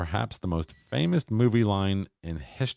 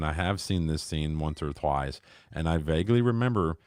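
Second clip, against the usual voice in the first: ordinary talk, nothing marked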